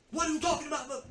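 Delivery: high, fast, loud mock outburst; yelling. Shown as a demonstration. Showing how he did not answer his coworker, yelling. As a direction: burst out yelling, high and rushed. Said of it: "I don't yell, but I raise my voice".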